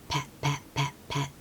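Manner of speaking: softly whispered pats; soft sound effect; soft patting sounds. A narrator's reading. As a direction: say each pet very softly.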